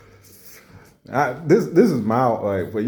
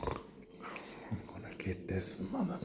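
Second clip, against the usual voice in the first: whispered, slow threat under the breath; slow whispered vow; muttered threat; 17 dB quieter, slower than usual. Pretend. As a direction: hiss it to yourself like a tough guy. Acting tough gum chewer.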